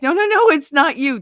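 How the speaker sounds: comic frantic backpedalling; hasty reassurance. Retold or imitated. Hastily reassuring an upset partner.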